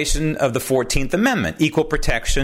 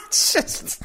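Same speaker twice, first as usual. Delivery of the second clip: high, breathless, giggling; cracking up while retelling it; laughing through words. Real, not acted. Host retelling a guest's joke on air, laughing high.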